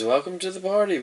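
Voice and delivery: lightly voiced high-pitched character greeting; welcoming elf. Drana greets the captured half-orc.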